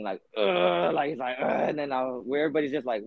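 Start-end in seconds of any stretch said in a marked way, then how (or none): 0.4-0.9 imitated grunt of pushing hard; straining grunt. Storyteller imitating the crackhead on the floor, strained grunt. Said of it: "he sounds like he's pushing". 1.4-1.7 imitated grunt of pushing hard; straining grunt. Storyteller imitating the crackhead on the floor, strained grunt. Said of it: "he sounds like he's pushing".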